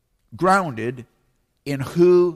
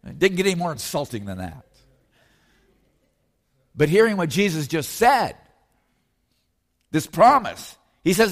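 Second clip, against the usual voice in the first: ordinary talk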